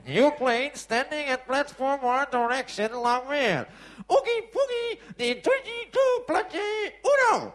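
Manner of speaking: high, loud tannoy sing-out; hammed-up announcer calling flights in garbled English; mock airport announcer. A narrator's reading. Airport announcer to all passengers, high and blaring.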